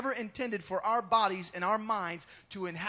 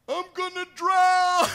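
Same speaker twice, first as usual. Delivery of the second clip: higher, louder, slow cry of panic; a sinking man's half-acted fear; mock terror, pitched up and loud; 8 semitones higher, 10 dB louder than usual. Peter sinking in the waves.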